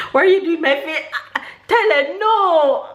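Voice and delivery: high squealed protest with laughter; laughing protest. Real, not acted. Mother whose face the baby grabs, laughing high protest.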